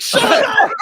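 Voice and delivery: high, loud shout. Retold or imitated. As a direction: yell it at him. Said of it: "it's like".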